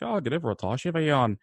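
put-on a second, bigger try at a Donegal accent. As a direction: exaggerate the Donegal accent, pitch climbing. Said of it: "combine that with a Donegal accent".

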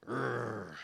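low, rough growl imitating the dog. Imitating the dog growling at dad. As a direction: growl deep like the cornered dog. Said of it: "like D M X".

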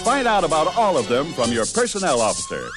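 normal talking voice

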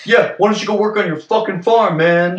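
mock heckling. Farmer and port worker trade insults, mock heckling. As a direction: jeer like a rival in a pretend feud. Acting out port and farm rivalry.

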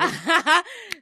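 bright burst of real laughter, high and loud. Real, not acted. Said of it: "laughs at friend's joke".

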